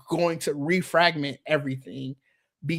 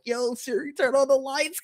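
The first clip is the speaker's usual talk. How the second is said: high-pitched call without real volume; mock yell; 9 semitones higher than usual. Freezing speaker calling Siri from a dark bedroom, mock yell. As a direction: imitate calling out to a voice assistant, pitched well up. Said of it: "I'm trying to yell".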